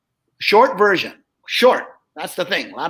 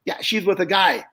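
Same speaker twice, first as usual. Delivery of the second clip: normal talking voice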